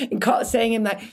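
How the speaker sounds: ordinary talk, nothing marked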